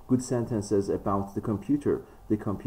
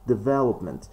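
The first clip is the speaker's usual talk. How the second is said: development said as de-VEL-opment; development with its second syllable, VEL, stressed; 3 dB louder than usual. Shown as a demonstration. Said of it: "This is the stress which is correct".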